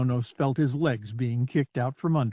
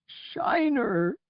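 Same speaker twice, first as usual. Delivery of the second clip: much higher, a falling cry of loss; anguished cry; 10 semitones higher, 3 dB quieter than usual. Acted. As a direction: call out the lost droid's name. Repeated cry for the droid.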